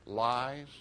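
ordinary talk

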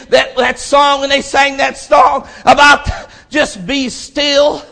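high, loud, impassioned shouting sermon; preaching at full force. Acted. Preacher rebuking complainers, shouting with fervor. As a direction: hammer it home to the congregation.

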